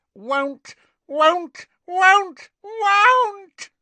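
high croak climbing to a loud scream; elf's rising scream. A narrator's reading. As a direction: shriek the refusal in a tantrum. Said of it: "Kreacher's voice had risen to a scream".

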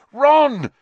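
loud, high desperate yell; shout. A narrator's reading. Harry yelling as Ron is dragged away, loud shout. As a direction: scream his friend's name after him. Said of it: "Harry shouted".

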